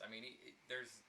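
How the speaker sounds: usual speaking voice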